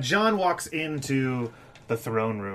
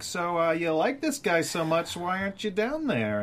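lightly voiced Varys; 7 semitones higher than usual. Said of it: "And Varys is like".

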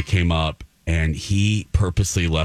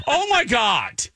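even higher hammed-up "Oh, my God" with a laugh; dramatic gasp. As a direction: overplay the shock, laughing through it. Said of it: "I'm so dramatic".

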